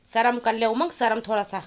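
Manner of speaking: high, loud mock shaming; loud teasing scold. Real, not acted. Mother loudly teasing son Umang to have shame. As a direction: tell him off, but you're amused.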